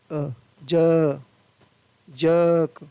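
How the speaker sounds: अ and ज held, then जर्क as a drawn-out "juhk"; long schwa. Shown as a demonstration. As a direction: stretch the अ vowel in जर्क, र dropped. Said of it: "अ है वो लंबा पढ़ा जाता है". (जर्क में अ को लंबा खींचकर)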